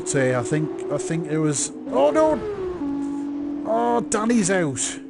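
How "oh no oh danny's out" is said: sudden high cry of dismay; shocked exclamation. Real, not acted. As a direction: gasp out the crash as it happens. Another car crashes out.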